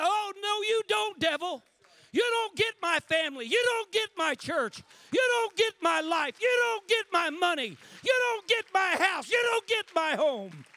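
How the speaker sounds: loud, high, ringing defiance; defiant shout at the devil. Pretend. Believer facing the devil, shouting defiant refusal. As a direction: stare the devil down and yell it. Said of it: "look the devil in the eye and say".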